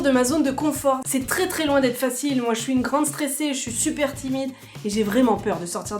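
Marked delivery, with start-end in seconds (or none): none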